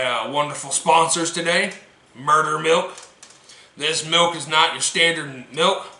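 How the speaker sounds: usual speaking voice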